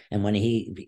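ordinary talk

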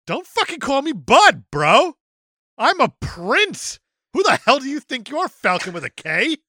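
high, loud mock outrage from a bratty prince; indignant prince. Kid prince snapping at Falcon over being called bud, loud mock outrage.

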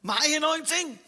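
put-on high, incredulous German-accented retort; German-accented outrage. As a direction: mimic an indignant German-accented woman. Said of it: "she went".

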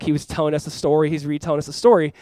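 ordinary talk

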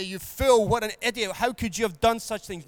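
mock scold the returning son; berating father. Father scolding his prodigal son, mildly hammed-up anger.

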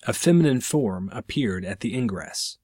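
ordinary delivery

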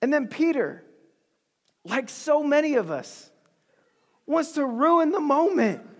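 usual speaking voice

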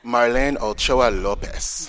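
slow, loud attempt at a Spanish accent for Marlene Ochoa Lopez; a self-mocking try at a Spanish accent. Shown as a demonstration. Podcast host names the victim in a Spanish accent. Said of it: "I have terrible Spanish accent".

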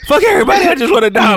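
hammed-up sulky child; mock tantrum kid; whiny kid. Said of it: "kid after whooping".

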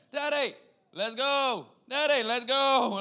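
high, loud, drawn-out call; demanding toddler. Retold or imitated. Toddler waking dad every morning, loud and demanding. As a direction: holler like an impatient small boy. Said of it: "I wake up to almost every day".

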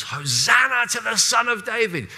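cheering crowd. Retold or imitated. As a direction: call it out like a welcoming crowd. Crowd welcoming Jesus.

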